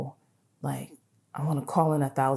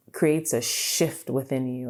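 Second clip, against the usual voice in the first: ordinary delivery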